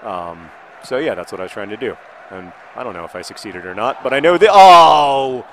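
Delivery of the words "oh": loud drawn-out "oh!"; exclaimed "oh". Real, not acted. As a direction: cry out at a sudden turn in play. Reacts to play in his match.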